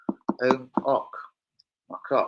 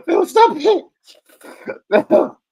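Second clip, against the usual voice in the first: very high, loud squeal through laughter; real laughing squeal; 9 dB louder, slower than usual. Real, not acted. Begging Phil to stop, real laughing squeal. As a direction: squeal it helplessly, cracking up. Cracking up at Phil's teasing.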